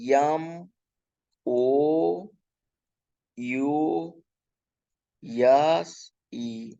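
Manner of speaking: very slow, drawn-out letters; spelling out. Shown as a demonstration. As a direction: stretch each letter and pause before the next. Spelling out mouse for students. (हर अक्षर खींचकर, रुक-रुककर)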